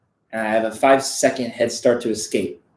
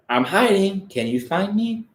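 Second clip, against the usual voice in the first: higher, softer, a lightly voiced ghost girl; hint of a creepy little girl; 6 semitones higher, 3 dB quieter than usual. Narrator voicing the ghost in the furnace room, lightly spooky. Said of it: "sounded like a little ghost girl".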